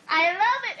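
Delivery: high, thrilled agreement; excited. A narrator's reading. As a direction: gush with delight. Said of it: "agreed Luella".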